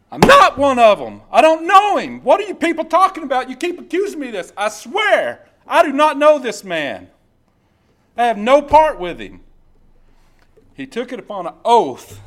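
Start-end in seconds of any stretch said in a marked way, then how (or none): 0.1-9.4 shouting, high, swearing he doesn't know him; furious denial. Acted. Peter swears to the crowd he never knew him, shouting. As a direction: blow up at the accusers in full force.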